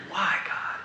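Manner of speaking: weeping. Retold or imitated. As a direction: cry out to God softly through tears. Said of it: "and just weeping".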